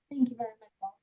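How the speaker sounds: breaking-up microphone; garbled, cutting-out mic audio; bluetooth cutting out. Real, not acted. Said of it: "it's hard to hear you".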